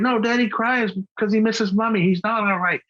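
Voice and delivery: high-pitched imitation of an insistent child; child's earnest objection, mimicked well above own pitch; young son. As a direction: imitate a little boy contradicting the grown-ups. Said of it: "Nathaniel was in the back seat".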